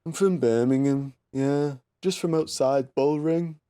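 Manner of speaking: droning mock Birmingham voice; monotone Brummie accent. Shown as a demonstration. Showing a friend the Brummie monotone, droning accent. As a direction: drone slowly in a Brummie accent. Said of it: "monotonastic, but".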